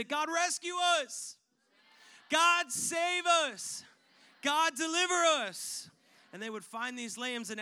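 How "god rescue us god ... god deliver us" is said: high, loud, slow cry to God; pleading shout. Acted. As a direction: cry out to God for rescue, drawn out. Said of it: "they would say".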